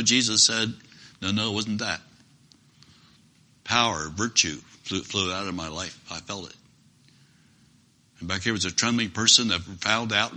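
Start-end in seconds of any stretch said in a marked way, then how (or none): 1.2-6.6 quiet, low and fading to near nothing; soft and hushed. Acted. Jesus telling Peter power flowed out, hushed and low. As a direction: speak it gently, almost to yourself.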